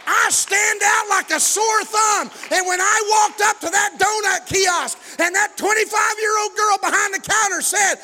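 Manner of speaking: high, loud pulpit shout with stretched words; full-force preaching. Real, not acted.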